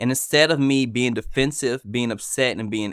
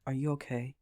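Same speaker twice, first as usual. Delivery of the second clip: quiet, soft, gentle check-in; softly asking what's wrong; calm check-in; 9 dB quieter than usual. Pretend. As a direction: answer the outburst with calm concern. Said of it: "How I do it".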